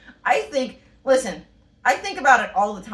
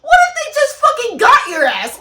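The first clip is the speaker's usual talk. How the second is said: shouting much higher and louder through laughter; laughing shout; 14 semitones higher, 10 dB louder than usual. Real, not acted. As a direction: yell it while cracking up. Laughing outrage at movie.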